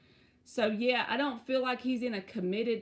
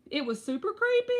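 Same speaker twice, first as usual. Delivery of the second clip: excited; 11 semitones higher, 4 dB louder, faster than usual. Real, not acted. Reliving the creepy text.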